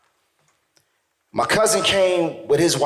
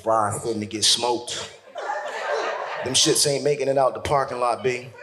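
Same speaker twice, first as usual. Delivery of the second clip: OG mode; 7 semitones lower, 5 dB quieter than usual. Acted. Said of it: "I went full O G mode".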